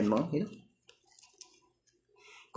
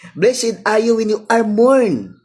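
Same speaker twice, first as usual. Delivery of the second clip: proclaimed scripture; 8 semitones higher, 7 dB louder, slower than usual. Acted. Quoting scripture emphatically.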